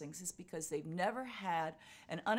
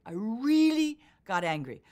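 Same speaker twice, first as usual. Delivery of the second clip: louder and slower, punching "really"; forceful emphasis; 4 semitones higher, 11 dB louder, slower than usual. Shown as a demonstration. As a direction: lean into "really got angry".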